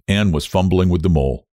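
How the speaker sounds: ordinary delivery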